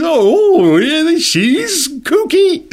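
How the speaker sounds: very high, slow, stretched-out ditzy voice; teen comedy voice. Shown as a demonstration. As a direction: play a kooky teen movie girl. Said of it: "It's always high".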